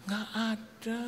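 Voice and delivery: high, soft drained reply, imitated; lifeless wife. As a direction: mimic a wife with no spirit left. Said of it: "mukanya gitu kayak nggak ada roh".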